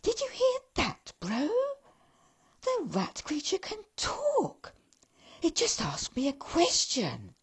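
high elf voice, astonished; amazed elf. Acted. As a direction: elf gasping to his brother in high amazement. Elf amazed at talking rat.

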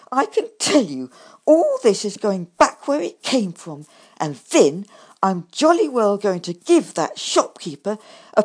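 loud, furious tirade, slowing at the end; angry rant. A narrator's reading. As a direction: storm off in a rage. Angry rant over bad shopping.

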